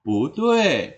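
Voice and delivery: "Pu tue" rising, then falling; good second-tone pu. Shown as a demonstration. Teacher repeating good tone, "Pu tue" rising then falling. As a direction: lift "Pu", let "tue" fall. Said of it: "menjadi bagus nadanya".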